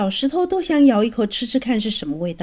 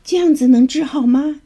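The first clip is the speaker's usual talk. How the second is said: worried grandma asking higher and louder; anxious question; 5 semitones higher, 5 dB louder than usual. A narrator's reading. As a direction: ask urgently, fearing the worst. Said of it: "鱼奶奶急着问". (焦急地追问)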